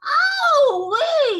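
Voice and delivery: very high, loud drawn-out howl; full-voiced shriek of pain; pained yell. A narrator's reading. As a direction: yell out as if your ear hurts. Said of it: "yelled the B F G".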